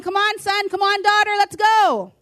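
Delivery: high, rising cheerleader call; God cheering his son and daughter onward, excited. Retold or imitated. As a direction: urge them forward like a coach. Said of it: "That's why he's saying".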